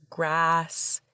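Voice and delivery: usual speaking voice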